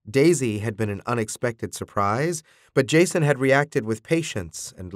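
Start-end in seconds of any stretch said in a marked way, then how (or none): none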